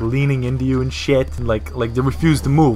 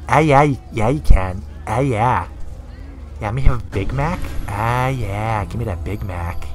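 low, soft creep savoring his order; creepy, self-pleased murmur sinking lower; 3 semitones lower, 3 dB quieter than usual. Pretend. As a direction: drag out the "ah, yeah" like a creep. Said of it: "that's the creep voice".

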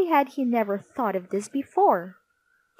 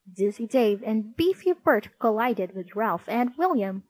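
ordinary delivery

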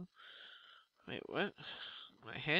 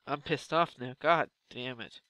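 ordinary talk

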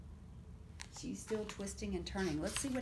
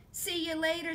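gator voice; 7 semitones higher, 4 dB louder than usual. A narrator's reading. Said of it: "says the gator as he romps".

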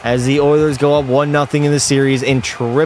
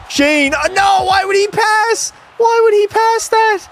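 frustrated shout. Real, not acted. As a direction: shout at the screen, can't believe the pass. Missed breakaway, player yells frustrated.

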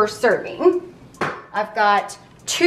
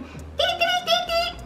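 playful high dee; 6 dB quieter than usual. Real, not acted. Playful sign-off filler.

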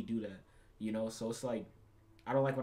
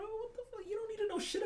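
shrill outrage, pitched way up; outraged high shriek; 3 dB quieter than usual. Real, not acted. Venting about a date who asked too much, outraged squeal. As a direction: squeal it in disbelief. Outraged at her date's question.

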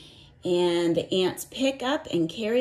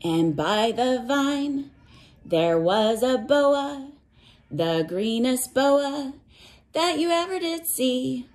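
put-on reading to children; 4 semitones higher than usual. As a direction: chant it like a picture book for little ones. Teacher reads cumulative rainforest book.

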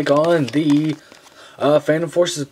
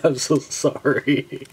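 words stretched out by real laughter; helpless laughing; slower than usual. Real, not acted. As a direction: apologize through a laughing fit. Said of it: "I'm laughing".

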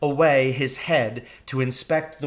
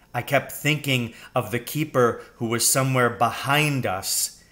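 plain reading voice

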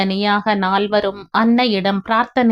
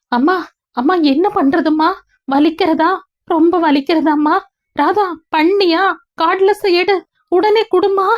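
high, loud frantic son, breathless with fear; pleading with mother and wife in alarm; panicked son; 9 semitones higher, 4 dB louder than usual. Acted. Son panicking over ill mother. (பதற்றத்தில் அலறும் மகன்)

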